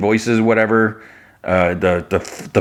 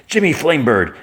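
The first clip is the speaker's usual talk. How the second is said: higher, mock-stern name call; scolding call; 6 semitones higher than usual. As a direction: lightly act out calling someone to account. Kara scowling, confronts Jimmy.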